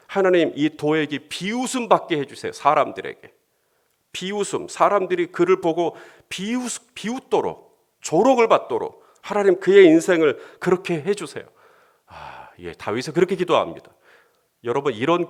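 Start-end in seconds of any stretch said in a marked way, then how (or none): 0.1-11.4 pleading prayer. Pretend. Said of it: "육 절을 보시면".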